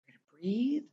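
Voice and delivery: "We're gonna breathe" whispered softly; soothing whisper. Shown as a demonstration. Guiding a distressed friend to breathe, in a whisper. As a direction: hush it to calm them. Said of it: "strike the soothing".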